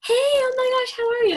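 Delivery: high, loud squealed hello; gushing greeting. Retold or imitated. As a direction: imitate an over-the-top gushy greeting. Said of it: "those people do it where they're like".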